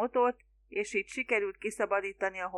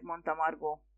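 ordinary delivery

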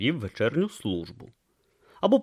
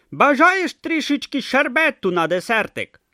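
hopeful hawker; 6 dB louder than usual. Acted. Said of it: "з надією запитав Дебіль".

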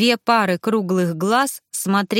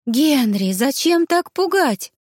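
higher, louder, slow startled reproach; startled by the ghost, breathe it out; startled exhale; 7 semitones higher, 4 dB louder, slower than usual. A narrator's reading. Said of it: "выдохнула я". (испуганно выдохнув, выше и громче)

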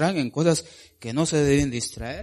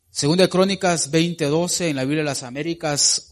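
ordinary delivery

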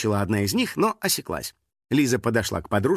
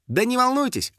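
high, breezy brush-off; dismissive; 12 semitones higher than usual. A narrator's reading. Girl brushing off her parents' worry, dismissive. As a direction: wave the worry away in a higher voice. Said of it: "отмахнулась девочка".

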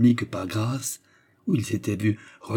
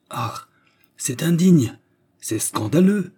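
high, loud, scandalised cry; indignant exclamation; 6 semitones higher, 5 dB louder, slower than usual. A narrator's reading. Wife hearing of the engagement, outraged. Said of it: "s'écria madame Delvig".

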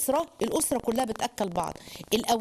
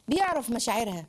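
ordinary delivery

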